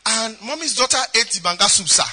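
usual speaking voice

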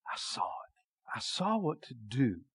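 slow awed whisper; whispered revelation. Retold or imitated. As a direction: breathe it out in wonder. Hushed moment of revelation.

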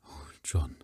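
breathless whisper of dismay; whispered despair. A narrator's reading. The wife whispers her despair to her husband. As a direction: grieve for him under your breath.

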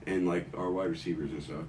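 ordinary delivery

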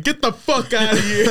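high, slow, dragged-out angry mom voice; imitating an angry mom. Friend imitating Mom hearing the podcast, mock-furious. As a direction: play the outraged mother, stretching every word. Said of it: "she's gonna be listening to this".

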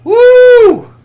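high, loud wrestler woo; Ric Flair woo. Pretend. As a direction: let out a huge wrestler woo. Ric Flair style cheer.

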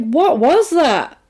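imitating someone taken aback; mock amazement. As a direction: act out an astonished question. Voicing Max questioning the boy.

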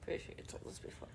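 whispered aside. Real, not acted. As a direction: breathe it as a whisper.

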